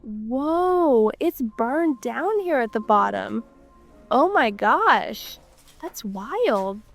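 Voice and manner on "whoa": usual speaking voice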